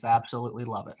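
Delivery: ordinary talk, nothing marked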